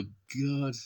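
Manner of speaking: usual speaking voice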